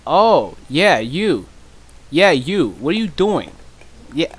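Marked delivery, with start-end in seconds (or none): none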